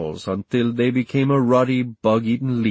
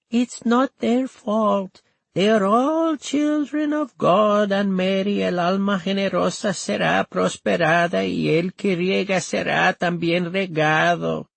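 put-on high, slow, quavering old woman's voice; a frail old grandmother, voice trembling; 9 semitones higher than usual. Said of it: "in Nana's quavering voice".

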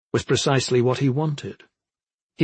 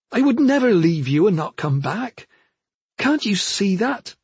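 higher, earnest, imploring voice; pleading sister; 7 semitones higher than usual. A narrator's reading. Sister trying once more to reach her brother, pleading. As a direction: implore him gently but urgently.

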